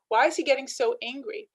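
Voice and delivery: ordinary talk, nothing marked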